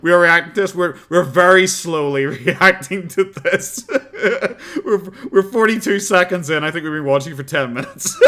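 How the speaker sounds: laughing while talking. Real, not acted. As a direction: giggle through the words. Laughing at her slow progress.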